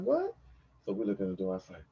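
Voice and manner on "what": very high startled question; startled what. Retold or imitated. Girlfriend hearing someone coming, startled high what. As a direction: jump at the word. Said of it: "She's like".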